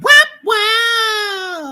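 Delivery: high excited wow; excited shout. Real, not acted. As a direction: shout it out in disbelief. Reacting to a play.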